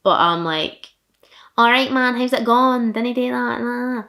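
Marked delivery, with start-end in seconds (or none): 1.6-4.0 higher-pitched, broad Fife Scottish patter; thick Scottish accent. Shown as a demonstration. Vlogger showing viewers how hard Fife is to understand, thick Scottish accent. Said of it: "It is the thickest Scottish accent".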